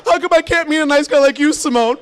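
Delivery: high, whining mock female voice; female friend. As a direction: imitate a friend lamenting her love life. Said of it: "She's like".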